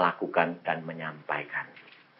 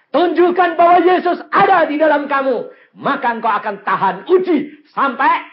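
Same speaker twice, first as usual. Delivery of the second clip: loud, high exhortation; full-force preaching; 11 dB louder than usual. Real, not acted. Preacher urging believers to endure testing, full-force preaching. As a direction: urge the congregation at full volume.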